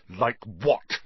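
spat. A narrator's reading. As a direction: spit the words at him. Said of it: "Harry spat".

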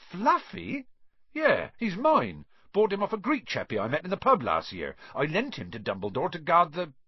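big friendly gamekeeper, chatting away; Hagrid, fondly boastful. Acted. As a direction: chat proudly about your odd pet. Voices Hagrid chatting about his dog.

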